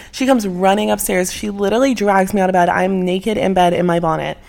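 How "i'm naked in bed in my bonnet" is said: ordinary talk, nothing marked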